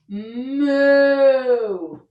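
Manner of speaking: loud playful moo; cow moo. Pretend. Yoga teacher mooing in cow pose for kids. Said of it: "Now let's do 'em together".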